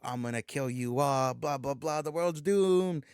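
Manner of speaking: hammed-up villain threat, last word stretched out; game villain. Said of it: "the big baddie of the game".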